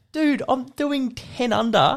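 very high, drawn-out mock disbelief; incredulous. Driver to the truckie who honked, high incredulous complaint. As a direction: stretch it out in a squeaky, exasperated pitch.

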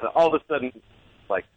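ordinary delivery

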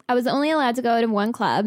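normal talking voice

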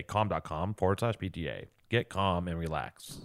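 hammed-up calm voice; mock soothing. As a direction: ease them to sleep with the URL.

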